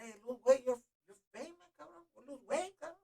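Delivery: high-pitched mock dad nagging; a teasing dad pushing him to lose weight; imitating his dad.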